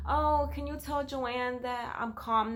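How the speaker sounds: put-on slow, lightly high voice of a calmed-down kid; small child voice. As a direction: ask it like a sniffly little girl. Child asks to be fetched.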